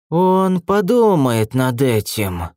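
slow serpent hiss; hissing snake voice. A narrator's reading. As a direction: draw the words out like a snake. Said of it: "прошипела змея".

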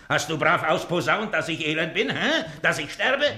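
giddy with anticipation; gleeful eager questions. Acted. Miser eagerly asking servant about the rumors, gleeful.

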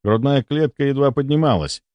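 ordinary delivery, nothing marked

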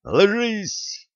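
sharp high-pitched command; sudden, high urgent yell; urgent warning cry. Acted. Warns comrade to hit ground.

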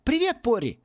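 put-on high, excited girl's call. A narrator's reading. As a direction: call a cheery greeting without real shouting. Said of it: "закричала девочка".

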